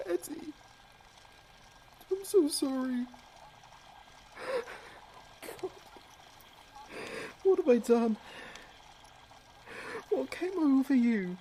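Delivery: distraught and shaken over the body; anguished grief. Acted. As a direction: sob out the apology. After killing his brother.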